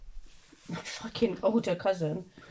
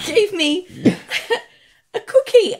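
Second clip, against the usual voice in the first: much higher and louder, a sheepish admission; son confessing; 12 semitones higher, 14 dB louder than usual. Retold or imitated. Son admitting to mum he ate a cookie, high. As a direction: blurt out the cookie like a caught kid. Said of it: "He said".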